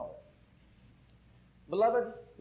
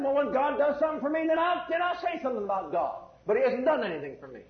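complaining believer; 7 semitones higher than usual. Acted. Preacher imitates a person holding out on God, high mock complaint. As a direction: put on a higher voice for a grumbler.

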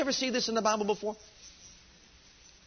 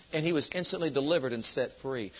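ordinary talk, nothing marked

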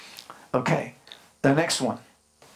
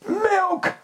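even higher wail on Milk; mock baby cry. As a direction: push Milk up into a thin falling wail.

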